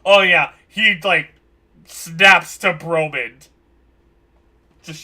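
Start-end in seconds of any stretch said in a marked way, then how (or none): 0.0-3.5 loud, slow startled jolt; sudden snap. Acted. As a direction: snap to attention loudly. GM voices Grim snapping.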